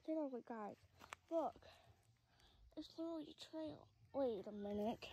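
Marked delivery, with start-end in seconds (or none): none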